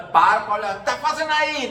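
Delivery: loud mock provocation; angry confrontation. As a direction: act out a hothead picking a fight. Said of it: "É o raivoso".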